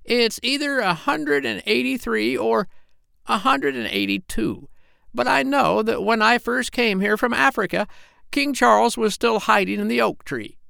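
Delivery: put-on very high old parrot voice; the parrot musing to the doctor, very high voice; parrot Polynesia. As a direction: play the parrot way up in the head. Parrot tells her age.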